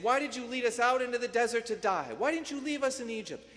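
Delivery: hammed-up whining crowd accusing Moses; grumbling complaint.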